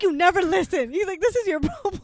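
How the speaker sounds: high, loud scolding dad voice; exasperated father, mock lecture; imitating dad. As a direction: do your dad nagging you.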